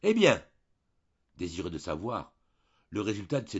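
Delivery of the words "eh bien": a high, loud eager cry; the priest cries out eagerly as she enters; exclaimed. A narrator's reading. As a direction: call out, eager for news. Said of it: "il s'écria".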